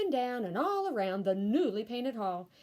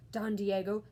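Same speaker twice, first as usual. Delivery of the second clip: ordinary delivery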